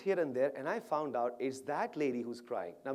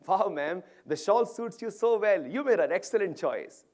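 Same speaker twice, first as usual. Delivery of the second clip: loud, higher-pitched gushing praise; big, loud admiring wow; enthusiastic compliment; 5 semitones higher, 9 dB louder than usual. Retold or imitated. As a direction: gush to cheer her up. Cheerful compliment to crying stranger.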